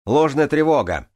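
loud, high call upward; shout. A narrator's reading. Swimmer shouts up to those waiting, loud call. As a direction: shout the all-clear across distance. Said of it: "я крикнул".

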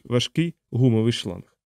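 plain reading voice

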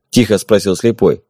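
ordinary delivery, nothing marked

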